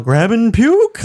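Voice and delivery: slow, high, hammed-up quote; mock game quote. Friends laughing over games, hammed-up quote. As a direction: drawl it out like a silly game character. Quoting a funny game line.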